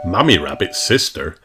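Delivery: ordinary delivery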